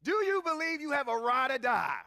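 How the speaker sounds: rousing the crowd to answer. Real, not acted. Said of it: "Look at these slow".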